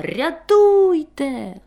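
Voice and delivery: high, drawn-out cry for help; scream for help. A narrator's reading. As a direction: wail for rescue. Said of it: "А вона заволала". (протяжно, високо заволати)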